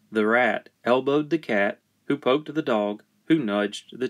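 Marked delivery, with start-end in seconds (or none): none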